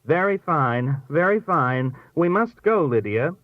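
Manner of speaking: brisk determination, climbing to a high push; determined. A narrator's reading. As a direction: cut firmly through the chatter. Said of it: "broke through the chatter with a determined".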